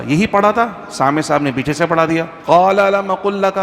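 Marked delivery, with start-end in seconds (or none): 2.5-3.6 prompting recitation. Retold or imitated. Listener prompts from behind.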